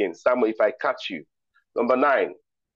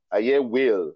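ordinary delivery

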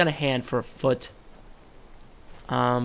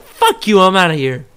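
louder, higher dismissive cat; loud mock-rude cat leaving; snotty cat voice; 6 semitones higher, 13 dB louder than usual. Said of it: "a cat is like".

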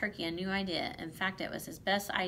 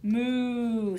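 long drawn-out moo, louder and higher; cow mooing; 3 semitones higher, 7 dB louder than usual. A narrator's reading. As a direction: bellow it like a storybook cow. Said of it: "said Cow".

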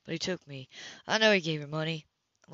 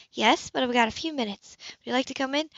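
higher, cool woman's voice, caught off guard; higher, cooler woman's voice to Cam at her door; Anna's voice; 7 semitones higher, 3 dB louder than usual. Acted. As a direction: play the composed woman surprised at her door. Anna speaking.

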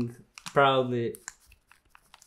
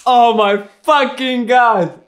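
high, slow, loud horrified yell; 9 semitones higher, 7 dB louder, slower than usual. Real, not acted. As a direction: drag it out in disbelief. Reacting to gross video.

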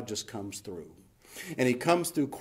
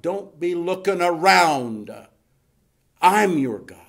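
loud, raised, drawn-out proclamation; full-force preaching; 6 semitones higher, 8 dB louder, slower than usual. Acted. Preacher voicing God's command not to look around, forceful. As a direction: stretch it out and let it ring.

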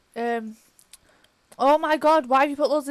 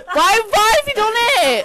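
higher, louder tearful plea; near-crying whine; 6 semitones higher, 4 dB louder than usual. Real, not acted. Upset gamer pleading with laughing partner, near tears. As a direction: whimper the question.

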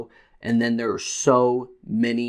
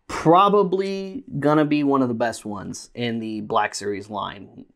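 usual speaking voice

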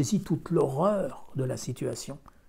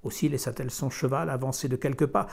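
ordinary delivery, nothing marked

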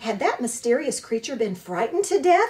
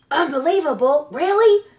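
much higher, louder delighted echo; flattered opossum; 6 semitones higher, 8 dB louder than usual. A narrator's reading. The opossum thrilled by the robot's praise, delighted. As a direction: fish for more praise. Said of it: "voice seemed to be softening".

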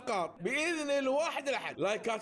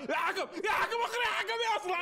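yelling at the ref; 9 semitones higher than usual. Real, not acted. Yelling at the referee.

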